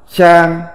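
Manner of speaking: "Trang" on a level Mandarin tone; Mandarin reading. Shown as a demonstration. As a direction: say "Trang" as the Chinese syllable, held level. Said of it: "Phiên âm tiếng Trung đọc là".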